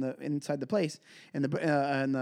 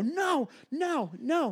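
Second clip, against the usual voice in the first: mock panicked refusal; 11 semitones higher, much slower than usual. Refusing the ride.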